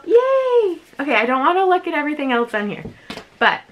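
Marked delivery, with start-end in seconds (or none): 0.0-0.8 high, drawn-out yay; excited cheer. Real, not acted. Vlogger pleased with her delivery, excited cheer. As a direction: let out a happy high squeal. Delighted at first unboxing item.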